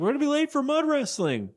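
high-pitched put-on whine of urgency; mock urgent complaint. Girlfriend hurries him to mud wrestling, high mock-urgent voice.

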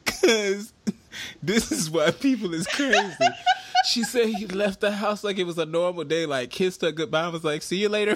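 high voice shaken by real laughter; can't stop giggling while telling it; laughing. Real, not acted. Retelling a divorce story, giggling.

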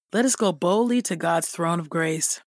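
ordinary talk, nothing marked

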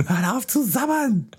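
very high, slow, fussy scolding; mock scolding. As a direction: scold the cartoon character. Mock critic scolding cartoon.

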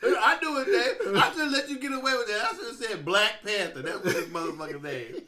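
loud, laughing admission; laughing at his own mistake. Real, not acted. Admits defeat laughing.

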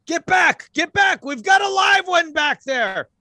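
high-pitched police shout; loud, high, frantic warning; yelling. Acted. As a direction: yell urgent orders to clear the way. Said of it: "they're yelling, though".

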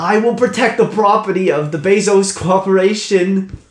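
stiff loyal guard. Acted. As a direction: recite it like a corporate guard. GM voices guard character.

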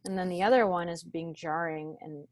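ordinary talk

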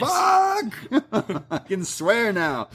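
very high, laughing "Fuck!"; a fan delighting in the swearing; gleeful shout. Real, not acted. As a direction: shriek it out through a laugh. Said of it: "gleeful cursing".

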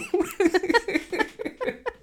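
loud, very high giggling fit; high-pitched laughter. Real, not acted. Host cracking up after a pockets rant, high laughter. Laughing at own abrupt change of subject.

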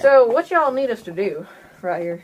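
a little Southern. Acted. Brother of the sailor asks the crew, light Southern accent. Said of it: "Go a little Southern".

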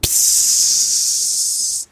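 hissing fire sound; sizzle. Acted. Fire hit by rain, sizzle hiss.

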